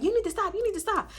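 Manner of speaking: high-pitched imitation of the crowd; mock scolding. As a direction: pitch it up, imitating everyone's nagging. Everybody scolding her in the story.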